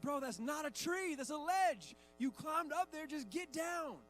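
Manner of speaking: high, loud mock-frustrated dad; exasperated arguing. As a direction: reason heatedly with an impossible kid. Exasperated dad argues with son.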